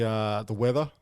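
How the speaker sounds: normal talking voice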